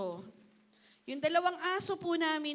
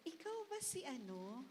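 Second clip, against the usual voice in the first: much softer, tentative asking; unsure question; 14 dB quieter than usual. Shown as a demonstration. Asking someone you half recognise, soft and tentative. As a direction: ask shyly, not sure it's them. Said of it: "feeling mo kakilala mo".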